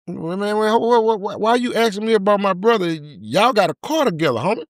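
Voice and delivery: high, stammering and defensive; mock nervous high voice. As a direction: stutter like a guilty man dodging. Dude stammers, acting nervous.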